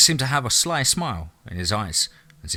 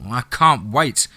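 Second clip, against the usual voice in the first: ordinary delivery, nothing marked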